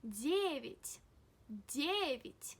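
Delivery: teacher's emphatic DYEH-vit for "девять"; stress on first syllable. Shown as a demonstration. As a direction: lean on "де" in "девять", then let it fall. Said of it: "the stress falls on Ye".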